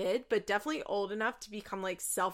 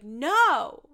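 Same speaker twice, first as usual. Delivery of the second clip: put-on loud, raised "no" of a child; retold indignant refusal; 4 semitones higher, 9 dB louder than usual. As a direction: blurt it out as an upset kid.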